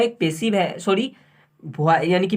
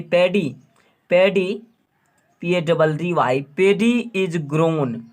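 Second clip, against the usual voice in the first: slow dictation; slower than usual. Shown as a demonstration. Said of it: "dictating answer slowly".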